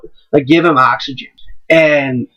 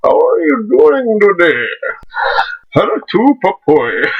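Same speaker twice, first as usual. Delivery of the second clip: lightly voiced Bane, slow and raised; a hint of a masked villain greeting; mock Bane hello, only slightly changed; Bane impression; 5 semitones higher, slower than usual. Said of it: "walking up in a Bane mask going".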